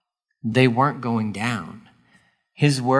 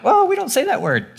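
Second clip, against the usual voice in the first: much higher, prissy mock voice; prim churchgoer; 13 semitones higher than usual. Listener scandalized by Jesus saying shut up, prim mock shock. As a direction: play the shocked church lady.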